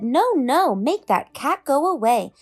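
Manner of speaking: the worried pet fish protesting; fretful fish. A narrator's reading. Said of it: "But our fish said".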